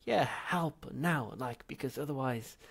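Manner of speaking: high, loud pleading; urgent. Acted. Zaphod pressing ghost for help, urgent. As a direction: hurry him up.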